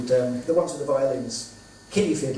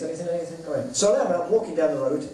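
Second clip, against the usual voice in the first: usual speaking voice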